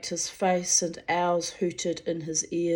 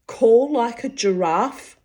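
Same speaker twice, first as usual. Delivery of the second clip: incredulous; 4 semitones higher, 6 dB louder than usual. A narrator's reading. Giant baffled.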